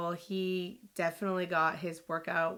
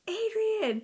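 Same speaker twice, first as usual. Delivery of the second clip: very high mock cry of alarm; retold scream. Mom as her daughter falls, high retold scream. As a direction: imitate mom screaming out a name. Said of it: "She screams".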